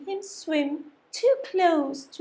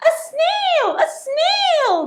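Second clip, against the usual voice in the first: high, loud alarmed shout; a startled cry at the snail; shrieking surprise; shocked teacher; 14 semitones higher, 6 dB louder, slower than usual. Acted.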